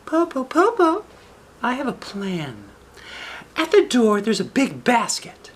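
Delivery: put-on very high little girl voice. Shang teases the wolf from the treetop, high girl voice. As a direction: talk up high like a young girl.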